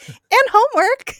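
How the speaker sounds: very high, loud blurt; blurted cover-up. Retold or imitated. Podcaster mimics Buffy covering her slip, high loud blurt. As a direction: tack it on in a sudden squeaky panic, mock-earnest.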